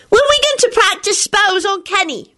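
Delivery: high, eager call; boy begging to practice spells; happy shout. A narrator's reading. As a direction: shout it like a thrilled kid. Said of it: "Teddy shouted happily".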